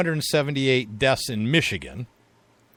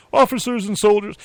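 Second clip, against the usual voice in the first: put-on Southern accent; 9 semitones higher, 5 dB louder than usual. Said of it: "it was a Southern accent they think they had".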